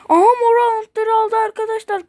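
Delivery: excited. Real, not acted. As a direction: shout the news with excitement. Excited at game event. (heyecanla bağırarak)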